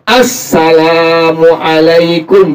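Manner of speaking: "Assalamualaikum" very slow, each sound clear; correct careful salam. Shown as a demonstration. Teacher modeling the correct salam for students. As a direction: spell out "Assalamualaikum" slowly and properly. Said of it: "kalau ngucapin salamnya bener".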